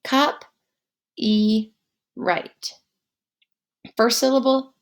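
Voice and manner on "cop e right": "Cop, e, right" in three separate syllables, long pause after each. Shown as a demonstration. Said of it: "Repeat those syllables".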